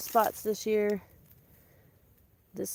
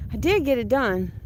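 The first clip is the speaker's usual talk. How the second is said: ordinary talk, nothing marked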